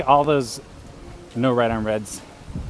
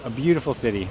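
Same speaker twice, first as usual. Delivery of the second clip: ordinary talk, nothing marked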